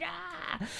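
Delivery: very high mock shriek, held back in volume; imitated scream. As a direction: squeal like an annoyed gamer. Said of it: "a screaming sort of fit".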